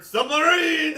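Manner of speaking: loud, high mock battle cry; yelled. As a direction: shout it high so everyone hears. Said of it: "everybody in the box definitely just heard".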